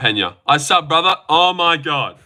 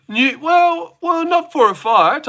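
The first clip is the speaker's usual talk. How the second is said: much higher, odd silly voice; put-on voice; 12 semitones higher, slower than usual. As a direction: answer the newcomer in a squeaky put-on voice. Answers newcomer in odd voice.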